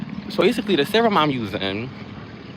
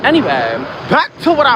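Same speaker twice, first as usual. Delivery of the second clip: loud reset; 12 semitones higher, 6 dB louder than usual. Real, not acted. Loud reset after aside.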